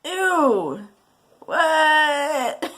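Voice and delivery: high, loud grossed-out cry; disgusted shriek. Acted. Son grossed out by mom, high and loud.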